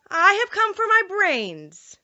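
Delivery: the Scarecrow asks the Wizard for his brains, a little uneasily; uneasy Scarecrow. Acted. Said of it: "remarked the Scarecrow a little uneasily".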